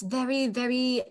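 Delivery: mock grand British stage accent; sophisticated British accent. Shown as a demonstration. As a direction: do hammy upper-crust British Shakespeare. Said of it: "tend to do Shakespeare in this".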